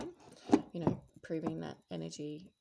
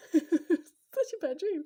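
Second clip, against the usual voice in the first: much higher and louder, laughing slowly through it; 13 semitones higher, 13 dB louder, much slower than usual. Real, not acted. To her viewers after a dream, slow and laughing loudly. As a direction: laugh the line out in disbelief.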